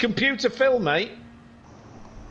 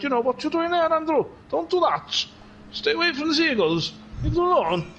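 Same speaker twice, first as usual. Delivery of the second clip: higher-pitched scolding Welsh mum; Welsh mother; 7 semitones higher than usual. Acted. Mother warning Andrew off the seagulls, Welsh scolding. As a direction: play a Welsh mum warning her child. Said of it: "And my mother, she said".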